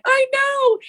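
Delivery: very high, giddy agreement; squealed I know. Retold or imitated. Answering friends' praise, very high and giddy. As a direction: squeal it back proudly. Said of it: "And I'm like".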